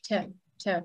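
Tờ as an aspirated English t; English-style airy tờ, repeated; English T sound. Shown as a demonstration. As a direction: release each tờ with a puff of air. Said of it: "Hai răng em chạm vô".

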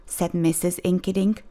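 ordinary delivery

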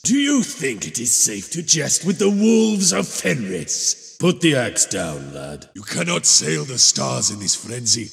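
ordinary talk, nothing marked

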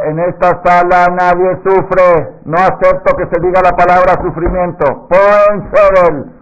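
stern midwife decree. Retold or imitated. Partera prohíbe la palabra sufrimiento, lenta, alta y firme. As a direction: lay down the law to the whole ward. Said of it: "le dijo la señora".

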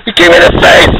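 high, loud, rising complaint; angry yell, IN rising. Real, not acted. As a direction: shout it again, voice climbing. Yelled again.

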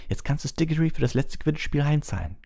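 a mild mock shriek of glee; screeching girl. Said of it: "kreischte Katie Bell".

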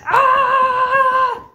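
high loud frustrated shriek; angry shriek. Acted. Teacher jumping up and down, shrieking in frustration. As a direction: shriek out in mock fury. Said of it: "teacher was so mad, she jumped".

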